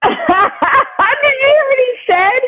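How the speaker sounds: high laughing squeal; laughing disbelief. Real, not acted. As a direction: shriek it through laughter. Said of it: "laughing at the joke".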